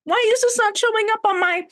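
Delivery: high-pitched outrage. Retold or imitated. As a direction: squeal it in disbelief. Outraged quote, cut off.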